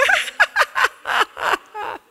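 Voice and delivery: high-pitched goofy laugh; teen laughing at nothing; silly high giggle. Acted. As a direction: cackle like a dopey kid.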